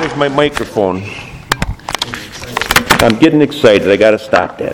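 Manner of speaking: very low-pitched, offhand aside; self-checking aside. Real, not acted. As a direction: drop right down to rein yourself in. Checks himself after getting excited.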